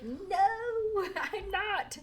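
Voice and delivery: high, laughing denial; protesting younger self. Retold or imitated. Her younger self denying the accent to the teacher, high laughing protest. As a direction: squeal the denial through a giggle. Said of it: "And I was like".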